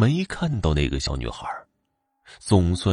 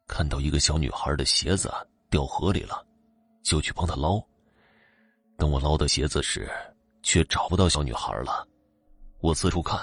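lower, softer voice of a tired father; father's voice; 3 semitones lower, 3 dB quieter than usual. Acted. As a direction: speak low and hushed as the father. Father's retold account.

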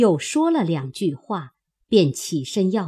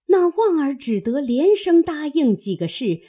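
ordinary delivery

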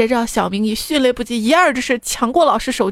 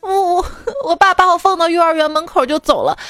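put-on high, stammering child voice; upset little boy; 6 semitones higher than usual. Son explains to mum about dad's mistake, upset child voice. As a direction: protest like a wronged schoolkid. Nephew explaining himself on phone.

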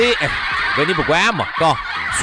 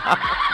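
high squeal of laughter; 4 dB quieter than usual. Real, not acted. Laughing while begging.

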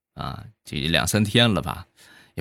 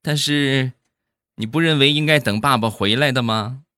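high, put-on mum voice; lifted pitch, sing-song surprise easing into a gentle question; imitated mother; 8 semitones higher than usual. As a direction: play a mother humouring her little girl. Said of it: "说他妈".

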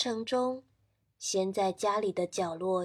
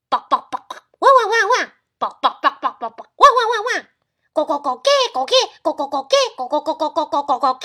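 loud high woofing mixed with clucks; barking and clucking; 5 semitones higher, 11 dB louder, much faster than usual. Acted. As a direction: rooster pretending to be a dog. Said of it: "奇怪的声音". (假装狗叫)

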